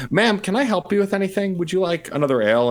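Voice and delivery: high, overly polite bartender; sugar-coat it while seething; sickly-sweet service voice. Acted. Bartender confronts the elf's pesterer.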